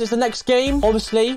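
usual speaking voice

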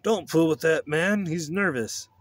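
loud, high command to a dog; shouted warning, easing at the end; shouting. A narrator's reading. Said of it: "I shouted".

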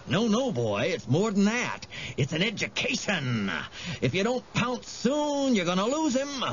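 goading Jive. A narrator's reading. Jive presses Harvey to pounce on his prey, goading. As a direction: lecture with rising urgency. Jive's urgent character voice.

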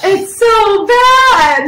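drawn-out, high wail about the taste; loud complaint. Real, not acted. Struggling to finish the wine, loud drawn-out complaint.

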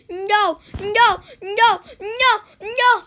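rising panicked cries of no. Real, not acted. Gamer caught by the police, panicked cries. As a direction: yelp it out in alarm.

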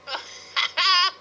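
short amused cackle; bright burst of chuckles; laugh. Real, not acted. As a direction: laugh at your own patter. Laughs after joking to viewers.

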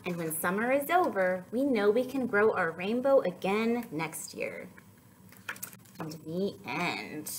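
slow, gentle storybook reading; picture book read aloud; reading to children. Acted. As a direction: read it like story time for toddlers.

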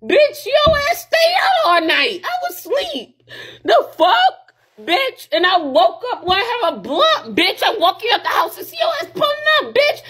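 shrieking up high in disbelief; outraged squeal at the partner; high-pitched furious rant. Real, not acted. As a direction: blast her for staying out all night.

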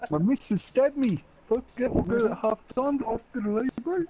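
high, loud, strained retelling voice; imitated injured bloke. Retelling the stabbed bloke's story to mates, high mock voice. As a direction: put on a high-pitched, dragged-out mock voice. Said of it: "he's like".

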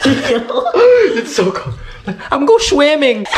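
high, slow laughing shiver. Real, not acted. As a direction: laugh while freezing. Laughing in ice water.

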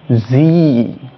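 Z held long on its "ee" vowel; drawn-out, emphasized Z. Shown as a demonstration. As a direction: stretch and stress the letter Z. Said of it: "you need little more duration".

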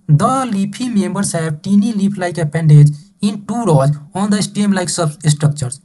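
slow, deliberate textbook reading; measured dictation pace; reading aloud. Acted. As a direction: read it out word by word for the class. Teacher reads textbook line aloud.

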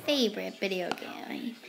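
soft unvoiced whisper. Real, not acted. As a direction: whisper it close. Whispered tip.

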